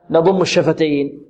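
ordinary delivery

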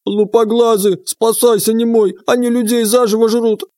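high desperate yell; panicked boy shouting warning. Acted. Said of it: "отчаянный крик".

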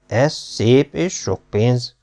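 slow, musing father's voice; a lawyer father mulling it over, slowly; thoughtfully. A narrator's reading. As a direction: weigh each word, pondering. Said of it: "mondta elgondolkodón".

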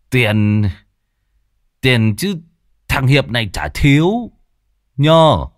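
put-on hiccuping drunk brag, rising loud at the end; drunk boast. Drunk Hiệp bragging to old Thoạt he has money, slurred and tipsy. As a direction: slur it out proudly, tipsy. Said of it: "Nó nấc lên mấy tiếng".

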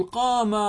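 قام with a long, loud, high drawn-out final aa (qaamaa); dual alef on قام. Shown as a demonstration. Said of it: "فكان يجب أن نقول". (مدّ ألف التثنية في آخر «قام» بصوت عالٍ)

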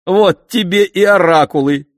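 loud, high, exultant cry; triumphant exclamation. A narrator's reading. Oedipus claps at the oracles' failure, exclaiming triumphantly. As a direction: crow it out as if proved right. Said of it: "воскликнул Эдип, хлопая в ладоши".

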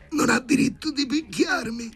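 put-on very high, squeezed drunken protest; half-strangled cry. Drunk Sharikov protesting the doctor's grip, half-strangled high cry. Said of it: "gridava Sharikov mezzo strozzato".

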